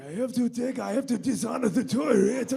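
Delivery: put-on Japanese accent muttered to oneself; mock Japanese accent. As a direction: imitate a Japanese accent, amusing yourself. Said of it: "we were doing, like, Japanese".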